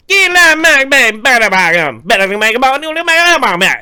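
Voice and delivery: loud, high, unintelligible Cajun rant; mock Cajun. Cajun drinker telling a story, loud mock-Cajun gibberish. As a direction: belt out garbled Cajun storytelling. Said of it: "this dude talking Cajun".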